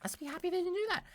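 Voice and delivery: quick, high imp voice; hurried little squeaky reply; imp agreeing. Acted. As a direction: agree hastily in a high creature voice. Diplin echoes the player's line.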